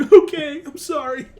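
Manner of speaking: high-pitched mock apology; cowed underling. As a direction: squeak out a meek surrender. Acting Reed giving in to orders.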